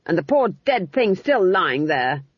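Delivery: put-on mildly scandalised old woman; snapped. A narrator's reading. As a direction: scold with a touch of indignation. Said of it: "snapped Granny".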